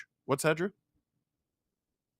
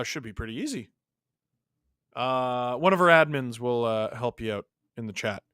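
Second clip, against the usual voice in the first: ordinary delivery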